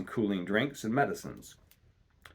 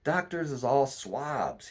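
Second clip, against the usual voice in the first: put-on slow, weak old sailor's grumble; a hint of a sick captain cursing feebly; sick captain scorns doctors, slow feeble grumble; 5 semitones higher, slower than usual. A narrator's reading. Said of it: "cursing the doctor in a feeble voice".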